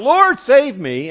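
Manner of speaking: high, loud, drawn-out plea for rescue; acted crying out. Sinking Peter cries to Jesus, panicked acted cry. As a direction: cry out for help as if going under. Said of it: "he cries out".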